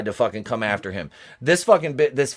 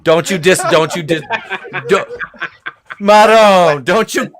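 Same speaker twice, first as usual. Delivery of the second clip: mock outrage; 3 semitones higher, 6 dB louder than usual. Mock-offended defence of a song.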